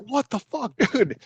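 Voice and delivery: high-pitched, held-back incredulous cry; mock shocked disbelief. Drop-in visitor reacts to man going crazy, mock shocked disbelief. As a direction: act out your alarm in a high, soft voice.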